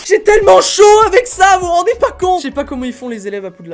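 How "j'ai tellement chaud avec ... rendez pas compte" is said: loud complaint. Real, not acted. Complaining loudly about heat.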